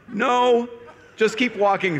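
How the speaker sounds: high, comic dread; mock panic. A priest walking away from an angry dad, high mock-panicked self-talk. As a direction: urge yourself onward in hammed-up alarm. Priest urging himself to escape.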